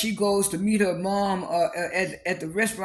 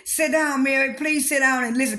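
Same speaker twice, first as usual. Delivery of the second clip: high, louder plea for her to sit down; pleading with Mary; 6 semitones higher, 4 dB louder than usual. Retold or imitated. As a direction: beg her to sit back down, pitched up. Whitney begs Mary to stay.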